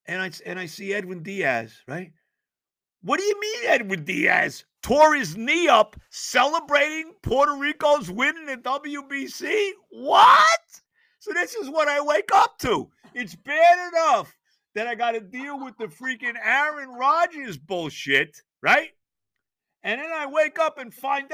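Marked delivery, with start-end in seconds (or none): 3.0-10.6 higher and louder, ending in a shrieked what; shocked outburst. Retold or imitated. Retold reaction to Diaz news. 11.2-16.9 loud rant. Real, not acted. Ranting about waking news.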